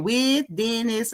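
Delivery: "with Dennis" slow, each word pressed; slow, pointed stress on "Dennis". Retold or imitated. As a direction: drag out "with Dennis" for effect. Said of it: "literally stressed it just like that".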